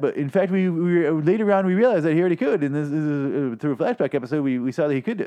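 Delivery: high, fussy nerd correcting a detail; convention dork. Acted. Fan at a convention citing a flashback, dorky pedant. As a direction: press the pedantic point, stumbling as you go. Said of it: "I feel like one of those dirt, dorks".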